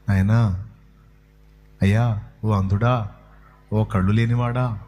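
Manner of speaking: mock-polite hushing; gently asking the blind man to hush; mock gentle. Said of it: "అని ఉంటారా?".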